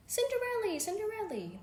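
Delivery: high squeaky mice calling out with joy; happy mouse shout. Acted. Woken mice greeting Cinderella, happily squeaky. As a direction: squeal her name gladly. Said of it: "the mice shouted happily".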